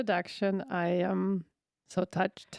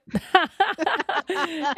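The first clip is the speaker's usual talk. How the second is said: high, louder falling laugh; real laughter, louder and falling; 9 semitones higher, 6 dB louder than usual. Real, not acted. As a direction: crack up at the innuendo. Laughing at her double entendre.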